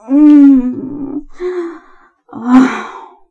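loud drawn-out hum, then a sighed "oh"; long swelling moan. Acted. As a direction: moan aloud and let the breath go. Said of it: "moaning at your touch".

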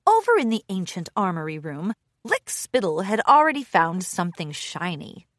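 ordinary delivery

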